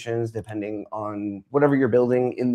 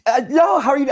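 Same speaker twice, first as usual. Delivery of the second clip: much higher and louder, flustered; mock flustered reply; 13 semitones higher, 5 dB louder than usual. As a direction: blurt it out high and startled. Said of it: "I'm like".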